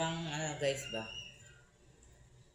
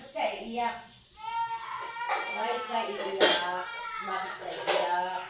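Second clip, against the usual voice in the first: raised, high questioning to a little one; 9 semitones higher, 3 dB louder than usual. Real, not acted. Asking child why it cries.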